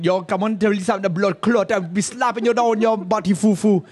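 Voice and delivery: put-on playful Jamaican accent threatening a slap. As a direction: childhood Jamaican accent imitation, laughing at the end. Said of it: "in Jamaican".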